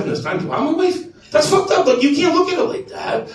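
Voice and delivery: high-pitched retold protest; comic husband's objection; aggrieved arguing. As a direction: plead the case, put out and worked up.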